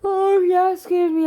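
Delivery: sleepy mid-yawn apology to the viewers. Real, not acted. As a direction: talk right through a big yawn. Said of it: "I'm yawning".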